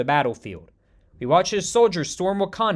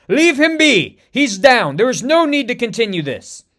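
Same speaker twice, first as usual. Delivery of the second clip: high, loud pleading cry; desperate scream; 6 semitones higher, 7 dB louder than usual. Acted. Okoye screaming at the villain to spare M'Baku. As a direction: scream to stop the beating. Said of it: "Okoye screams".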